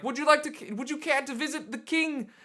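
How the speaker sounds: high, mannered herald voice; bow and invite like a courtly servant; pompous royal attendant offering an audience; formal courtier. Retold or imitated.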